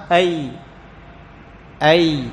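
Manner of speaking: "أي" with an unclosed, flowing y; open glide ya. Shown as a demonstration. Teacher shows tongue not touching palate, "أي" held open. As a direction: let the y of "أي" ring on through a slight gap. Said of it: "يحصل فراغ يسير".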